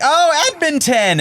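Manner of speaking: very high, slow, excited squeal; delighted strangers. Retold or imitated. Bathhouse strangers thrilled by Edmonton, very high squeal. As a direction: gush with delight at the city name. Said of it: "they're like".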